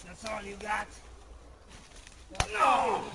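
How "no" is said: defiant shout; loud shout. Acted. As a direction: bark it out loud.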